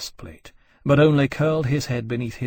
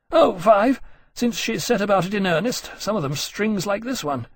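higher-pitched, lively grumbling officer; lightly voiced exasperated officer; 9 semitones higher than usual. Officer grumbles to his friend about the dragon, exasperated. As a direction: complain about her with rueful energy. Granby voiced, reporting to Lawrence.

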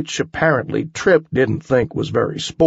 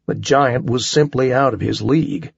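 usual speaking voice

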